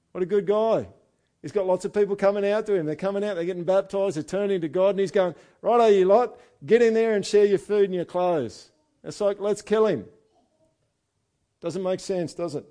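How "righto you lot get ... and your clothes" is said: loud, high, hammed-up call to the crowd; a preacher hamming up John the Baptist yelling at the crowd; mock Aussie shout. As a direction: bark it like a bloke rounding up mates. Preacher voices John calling crowds.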